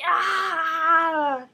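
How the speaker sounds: long, drawn-out "aah" trailing off; hammed-up wail of dismay; horrified cry. Tessa reacts to marriage news, drawn-out horrified cry.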